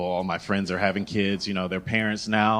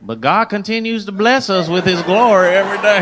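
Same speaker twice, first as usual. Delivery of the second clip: high, loud praise testimony; mock devout Southerner; 9 semitones higher, 8 dB louder than usual. Southern shopper after listing tragedies, mock devout exclamation.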